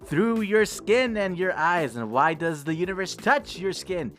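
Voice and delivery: rising dramatic recital; poem reading building up. A narrator's reading. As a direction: swell toward the question. Reads poem aloud dramatically.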